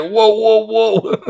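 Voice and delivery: high, drawn-out shocked whoas; stunned whoa. Retold or imitated. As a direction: gasp out each whoa in disbelief. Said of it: "It's like".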